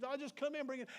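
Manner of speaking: playful imitation of a child asking for a hug; mock hug plea. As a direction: beg for a hug like a kid. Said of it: "and it's".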